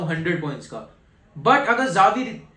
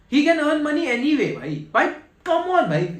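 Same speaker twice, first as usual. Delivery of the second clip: exasperated insistence; 8 semitones higher than usual. Real, not acted. Insisting Messi doesn't need money.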